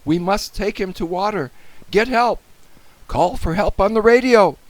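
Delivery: high, rising to loud; urgent plea. A narrator's reading. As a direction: urge the officer to act. Boy urges policeman to act.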